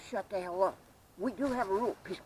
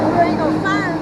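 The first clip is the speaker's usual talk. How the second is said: high, loud retort; high loud mock defiance; defiant phone guy; 12 semitones higher, 12 dB louder than usual. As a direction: throw it back as a cocky retort. Voicing the defiant phone talker.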